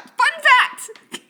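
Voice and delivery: Fun fact! yelled high and loud; announcer shout. Acted. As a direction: belt it like a show segment. Shouting the show segment name.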